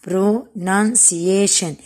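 "Pronunciation" slowly, in separate chunks; broken into syllables. Shown as a demonstration. Teacher repeating the split word for pupils, "Pronunciation" syllable by syllable. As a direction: sound out "Pronunciation" one syllable at a time. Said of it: "لاحظوا كمان قطعها شوي".

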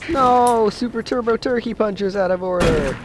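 high, loud whiny protest; mock outrage. Real, not acted. Mock outrage at broken machine.

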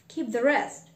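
ordinary delivery

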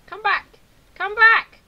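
high, loud call after a runaway; shouting. Real, not acted. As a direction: yell after the runaway cow. Chasing a fleeing cow.

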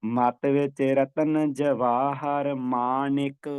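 very slow recitation ending ਮਾਣਿਕ with a clear short i; ਮਾਣਿਕ said maa-nik, the ਣ voiced with an i; slow chant, ਮਾਣਿਕ not ਮਾਣਕ; ਮਾਣਿਕ with sihari. Shown as a demonstration. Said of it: "ਨਾਣੇ ਨੂੰ ਸਿਹਾਰੀ ਉਚਾਰਨ ਕਰਨੀ ਹੈ".

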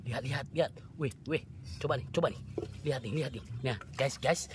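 soft, low suspicious voice; low, quiet suspense; hushed. Real, not acted. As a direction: murmur as if something may flee. Watching something suspicious, keeping still.

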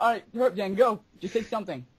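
ordinary talk

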